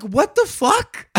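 high, loud, drawn-out what-the-fuck; exasperated disbelief. Retold or imitated. Player reacting to losing to junk cards, exasperated. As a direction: blurt it out incredulous, near laughing. Baffled rant at poker loss.

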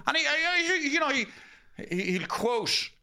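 lightly voiced pompous old author; pompous writer voice.

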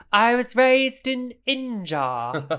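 high, loud, slow mock-posh British accent; [name]'s fake British. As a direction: ham up a grand posh English lie. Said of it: "my favourite bit".

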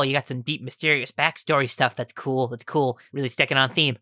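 ordinary delivery

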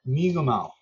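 Mi'kmaq in three syllables, "mee-guh-maw". Shown as a demonstration. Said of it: "There's actually three syllables in the word".

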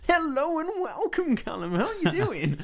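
hammed-up commercial radio host. Shown as a demonstration. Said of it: "like, Heart F M".

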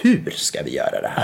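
whispered, low worried question; hushed police aside. Retold or imitated. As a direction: whisper it like a cop muttering to a colleague. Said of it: "det första de säger bara".

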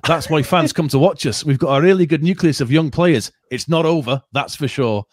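hammed-up Scottish accent with a hint of Yorkshire creeping in; Scottish accent impression. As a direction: play the Scottish boss defending a loss, slipping toward Yorkshire by the end. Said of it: "Do the voice".

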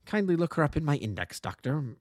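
ordinary delivery, nothing marked

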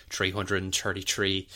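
generic Southern Irish accent, th said as t; generic Dublin Irish accent. Shown as a demonstration. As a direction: do the stock Dublin "tree" for three. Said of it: "it was gonna be Dublin".

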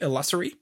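short "uh" vowel and s sound in "Illusory"; own pronunciation. Shown as a demonstration. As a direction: say "Illusory" as il-LUSS-ery. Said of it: "'cause I would say illusory".

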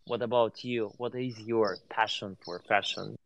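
ordinary delivery

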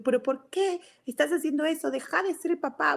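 high, exasperated mock telling-off; retold scolding. Mother tells son he is not the dad, retold high scolding.

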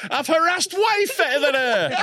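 high, laughing mock boast. Real, not acted. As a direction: laugh it out as a joke.

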